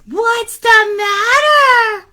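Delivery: put-on high, slow, drawn-out kid voice; concerned boy Aaron. Boy asking screaming lady, high and drawn out. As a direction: call it out high, stretching the last word. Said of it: "asked Aaron".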